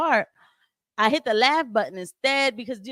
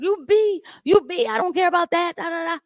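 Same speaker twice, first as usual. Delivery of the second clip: much higher parody of her yelling; mock the name-calling in a raised voice; mock insult rant; 9 semitones higher, 3 dB louder than usual. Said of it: "I'm not gonna start".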